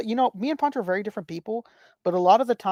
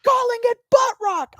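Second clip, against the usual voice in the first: high, drawn-out mock shout; hammed-up shrieky plea to stop; retold exasperated yell; 3 dB louder, slower than usual. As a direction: imitate someone at the end of their patience. Acting out exasperated fiancée yelling.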